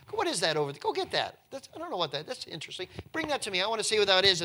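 high, eager royal commands to her maiden; bossy curious princess. Pretend. Princess at her bath ordering her maiden to fetch the basket, high and eager.

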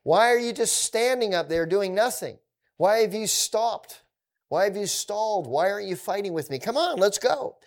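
Goliath taunting Israel, a hammed-up jeer; taunting giant. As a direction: goad the army from across the valley. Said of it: "this enemy, says".

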